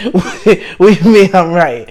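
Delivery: amused, incredulous retelling; laughing disbelief. Retold or imitated. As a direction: laugh while mimicking your baffled comeback.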